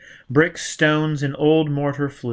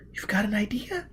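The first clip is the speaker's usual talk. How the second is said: put-on young woman voice; 9 semitones higher, 6 dB quieter than usual. A narrator's reading. Gisbella's voice.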